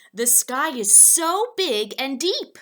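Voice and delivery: awed big-sky exclaim; overwhelmed exclaim. Acted. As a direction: swept up in wonder.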